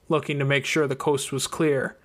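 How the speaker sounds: usual speaking voice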